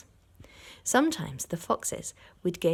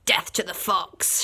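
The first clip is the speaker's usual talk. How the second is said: ordinary delivery, nothing marked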